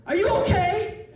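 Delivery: high, loud, slow over-careful "are you okay"; mock loud concern. Stranger overdoing concern for a blind man, high and loud. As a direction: talk to him like he's hard of hearing.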